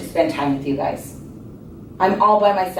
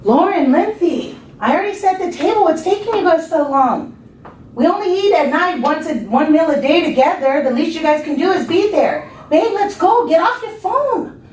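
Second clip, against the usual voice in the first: high, exasperated call across the house; yelling across the room; 9 semitones higher than usual. Acted. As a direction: yell for them, getting fed up. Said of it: "came out to the living room yelling".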